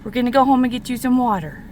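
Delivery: loud, cheerful call to the dog; loud dog talk. Real, not acted. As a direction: sing it out to the pup. Talking to the dog.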